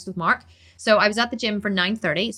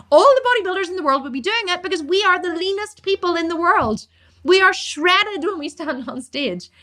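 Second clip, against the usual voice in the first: real rant building from firm to high-pitched and fired up; heated tirade, key words stretched out, rising to a high pitch; impassioned rant; 5 semitones higher than usual. Real, not acted. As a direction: start emphatic, climb higher and more insistent as you go. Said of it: "let me have a one-minute rant".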